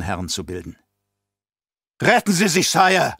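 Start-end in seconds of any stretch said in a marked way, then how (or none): 2.0-3.2 loud urgent roar. Acted. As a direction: bellow a warning to the emperor. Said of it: "brüllte ein Offizier".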